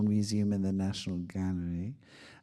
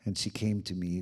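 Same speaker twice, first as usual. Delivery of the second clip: ordinary delivery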